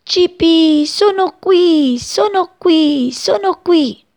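high, loud, slow calling out; mother bird calling. A narrator's reading. Mother bird calling her chick from outside, high and loud. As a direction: call out high for the lost chick.

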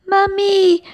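high, loud frightened chick calling mummy; high desperate cry for help. Acted. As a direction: scream for mother while falling. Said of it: "gridò con tutta la voce".

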